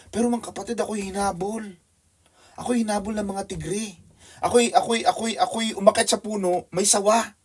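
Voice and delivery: high, breathless retelling of being chased; excited testimony. Acted. Missionary testifying to the church about the tiger, excited and high.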